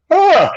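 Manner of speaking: high stretched fake yawn; mock yawn. A man yawning at his pet bird on the exercise machine, high mock yawn.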